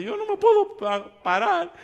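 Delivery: high, loud, broken sobbing plea; imitating his sobbing. Preacher imitates the crying man, high sobbing and loud. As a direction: act out the man weeping who cannot stop. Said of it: "Y sigue llorando".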